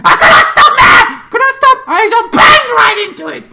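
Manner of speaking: high, loud, frantic shouting; panicked shout. Retold or imitated. Retelling the crash to viewers as a loud panicked yell. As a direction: yell it like you can't brake in time.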